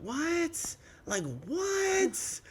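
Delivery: high, drawn-out disbelief; retold baffled reaction; stunned. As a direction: let the what hang up high. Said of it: "I was like".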